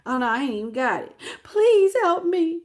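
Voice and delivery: a pretend sob begging for help; mock begging.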